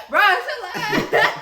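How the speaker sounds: loud high laughter; laughing. Real, not acted. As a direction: laugh through it. Laughing at nickname.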